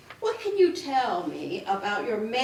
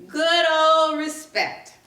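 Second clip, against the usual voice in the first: mocking; 5 semitones higher, 6 dB louder, slower than usual. Mocking the employer's word.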